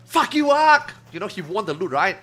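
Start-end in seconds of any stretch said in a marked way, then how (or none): 0.0-0.9 loud, high triumphant shout; gloating yell. Retold or imitated. Darwin yelling at Ark after winning loot, high triumphant shout. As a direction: crow it out at full volume. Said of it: "Darwin was like".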